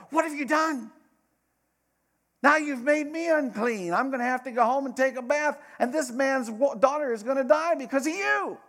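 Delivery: high, loud mock scolding; imagined yelling. Imagined Jesus yelling at the woman, high and loud. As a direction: yell blame at her, hammed-up. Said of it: "expecting Jesus to yell at her".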